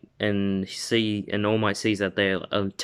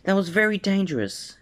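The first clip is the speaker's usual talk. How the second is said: higher mother voice, scolding gently; worried mom voice; 11 semitones higher than usual. Acted. Mom scolding her son after the villain fight, in a higher mother voice.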